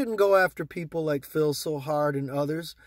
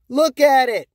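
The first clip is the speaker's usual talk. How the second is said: loud, higher, pleading look at it; exasperated shout; 5 semitones higher, 8 dB louder than usual. Real, not acted. Urging investors to check chart, loud exasperation. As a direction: force them to look.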